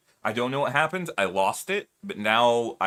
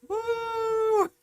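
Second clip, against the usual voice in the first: squeaky high cheer; high falsetto woo; 3 dB louder than usual. Real, not acted. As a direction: whoop it up in a falsetto. Cheering for the leaf blowers.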